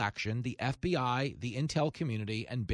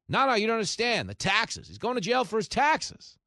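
higher, louder mock insistence; mocking quote; 8 semitones higher, 4 dB louder than usual. Commentator mocking the tax prediction, in insistent mock whine. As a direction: whine it like a sure believer.

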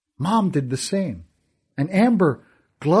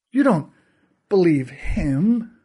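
higher, drawn-out disbelief; incredulous; 5 semitones higher, 4 dB louder, slower than usual. Acted. Narrator asks Fergal in slow disbelief. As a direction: stretch the doubt out slowly.

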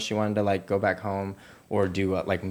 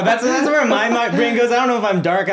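high, laughing and loud; giggling at herself; 13 semitones higher, 9 dB louder than usual. Real, not acted. Joking about her suspicious mind.

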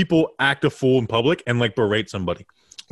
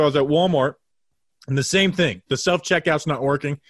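usual speaking voice

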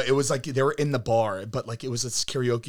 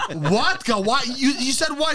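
incredulous; 11 semitones higher than usual. Retold or imitated. Retold disbelief.

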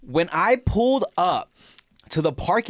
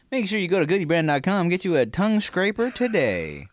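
ad read; 4 semitones higher than usual. Acted. Brand deal plug read.

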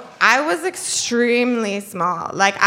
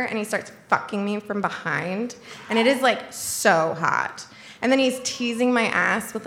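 ordinary talk, nothing marked

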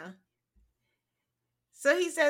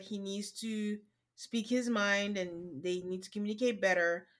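ordinary talk

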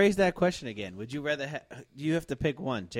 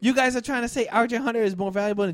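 heated; 8 semitones higher, 6 dB louder than usual. Real, not acted. Heated argument over roster.

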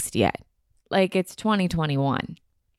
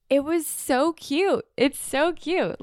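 much higher, slow gushing; 10 semitones higher, slower than usual. Real, not acted. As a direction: swoon over how cute it is. Gushing about the gift.

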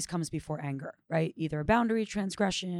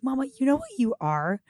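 higher, louder voice of a daughter; laughing daughter; 8 semitones higher, 5 dB louder than usual. Retold or imitated. Daughter teasing mama in the morning, in a high child's voice. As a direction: raise the voice as an excited child addressing mama. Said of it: "she was laughing. She goes".

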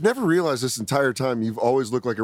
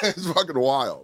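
ordinary talk, nothing marked

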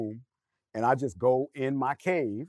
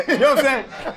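laughing it off, pitched up and louder; 11 semitones higher, 7 dB louder than usual. Real, not acted. To the panel, covering an awkward slip with a laugh. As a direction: laugh through the line after an embarrassing slip.

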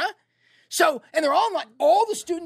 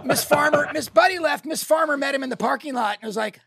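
ordinary delivery

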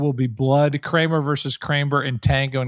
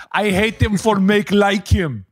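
put-on a playful Czech accent, a bit higher and slow; mock Czech accent; 6 semitones higher, 4 dB louder than usual. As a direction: repeat "I hate him for make like him" in a teasing Czech accent. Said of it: "the Czech Republic".

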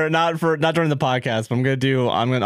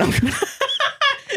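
high squeal laugh. Real, not acted. Blame the intern joke.

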